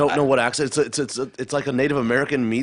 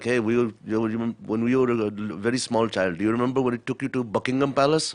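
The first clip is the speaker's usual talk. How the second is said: measured Indian English, imitating a dad; dad's Indian accent. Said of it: "my dad would be like".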